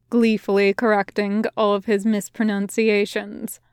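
plain reading voice

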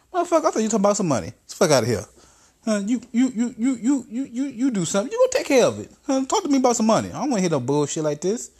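fast, high squealing rant with a laugh; spluttering high boss tirade; Trump rant. Acted. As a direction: rattle it off way up high. Trump character ranting.

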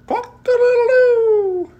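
very high loud rooster call; rooster crow. A narrator's reading. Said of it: "He can go like a rooster".

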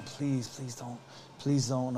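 low, soft retold begging; subdued pleading; hushed plea. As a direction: beg quietly to yourself. Inward anxious plea, retold.

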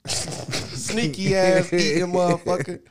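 laughing through a slow, drawn-out tease; amused ribbing through laughter. Real, not acted. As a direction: chuckle it out slowly at him. Teasing friend for eating on mic.

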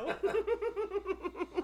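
high burst of laughter. Real, not acted. Big laugh.

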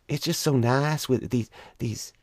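lightly mock the sweet-voiced bigot; mock genteel Southern mother, pleased; imitating the girlfriend's mom. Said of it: "She goes".

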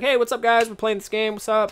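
a hint of stream-host chirp; mock streamer greeting. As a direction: imitate yourself casually greeting viewers. Acting out casual stream greeting.

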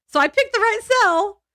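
high, laughing triumph. Real, not acted. Gloating to her co-host about the cell, laughing. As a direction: crow it with a laugh, pleased to be right.

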